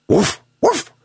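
high, short imitated woofs; high-pitched doggy woofing; dog bark. A narrator's reading. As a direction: bark twice like an eager dog. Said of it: "barked the dog".

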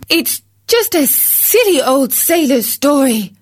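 high, scoffing wife voice; dismissive hen wife. A narrator's reading. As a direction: wave away his story as nonsense. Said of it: "said his wife".

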